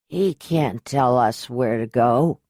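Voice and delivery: put-on drunk, slow, words slurring together; slurred drunk voice. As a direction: slur it like a drunk man. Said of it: "a slurred voice called out".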